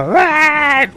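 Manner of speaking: sudden high, loud yelp from a passing car; mock heckling yell. Coworker yelling from his car window, imitated yell. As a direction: blurt it out like a heckler. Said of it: "scream shit out of his window".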